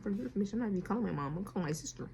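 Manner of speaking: quiet retort. Retold or imitated. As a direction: mutter it back quietly.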